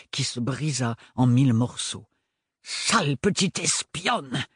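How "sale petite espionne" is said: snarling accusation. Acted. Furious professor accuses her.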